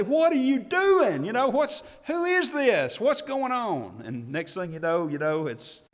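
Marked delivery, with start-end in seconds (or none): 0.0-4.0 louder, higher, slow exasperated outburst; exploding parent. Retold or imitated. Parent exploding at noisy kids, exasperated shout. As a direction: blow up at the kids. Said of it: "you explode and say".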